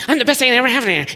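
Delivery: high, fast, self-satisfied; smug boast. Acted. Pastor mocking a wife's smug boast, high and fast. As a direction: toss it off, full of yourself.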